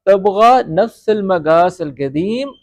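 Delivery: slow, careful تبغى نفس المقاس القدیم; teacher modelling slowly; slow model phrase. Shown as a demonstration. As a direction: dictate it slowly. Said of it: "کیا وہی پرانا size".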